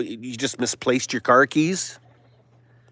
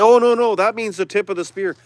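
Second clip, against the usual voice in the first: mocking quote; 7 semitones higher than usual. Ridiculing the people who dismiss him, mocking. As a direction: toss off their excuse like it's absurd.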